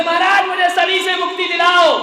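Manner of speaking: loud, high plea; begging shout. Retold or imitated. Mother-in-law begs the monk at full voice for release from Saturn.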